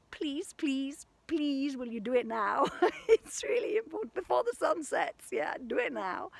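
high, loud, excited begging; urgent plea with a laugh in it; playful pleading. Real, not acted. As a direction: beg them eagerly, half laughing. Urgent plea to viewers, half laughing.

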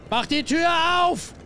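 loud, high-pitched scream for the door; frantic high shout; panicked shout. Real, not acted. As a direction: yell it in sudden panic. Panics at game threat.

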